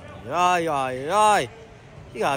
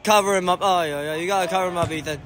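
normal talking voice

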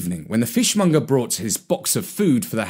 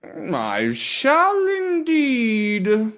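very slow, lazy aristocratic drawl; unhurried, lordly and bored; snooty bored cat; 9 semitones higher, much slower than usual. Acted. Gatsby answers.

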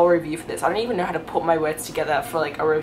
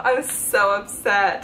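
ordinary delivery

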